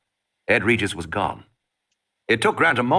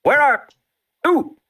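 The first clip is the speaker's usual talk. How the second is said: tense; 5 dB louder, slower than usual. A narrator's reading. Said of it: "Arnold's tense voice".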